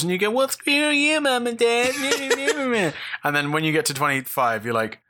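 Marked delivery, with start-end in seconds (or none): 0.3-2.9 put-on bratty teen. Teen defiance quote.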